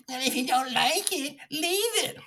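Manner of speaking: mock high jeering voice; mocking patriot. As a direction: parody a smug flag-waver. Mocks "love it or leave it.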